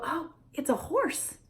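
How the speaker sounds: high, slow parentese labeling; high singsong toddler talk; modeling the answer. Shown as a demonstration. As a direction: show the child the answer brightly. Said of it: "you go".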